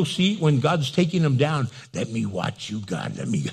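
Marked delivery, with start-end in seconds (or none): 1.9-3.5 low, soft, eager mock plea; hushed, gleeful anticipation, played for laughs; gloating spectator. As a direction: relish it under your breath. Gloating spectator of God's revenge.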